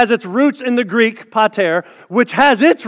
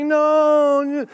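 whiny Luke; 5 semitones higher than usual. Retold or imitated. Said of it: "Luke's all whiny and stuff".

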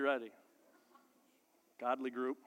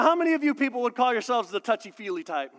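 usual speaking voice

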